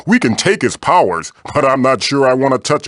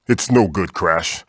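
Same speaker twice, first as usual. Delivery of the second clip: usual speaking voice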